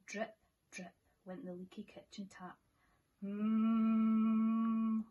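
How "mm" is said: long steady hum; loud droning refrigerator hum; fridge hum. Acted. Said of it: "went the refrigerator".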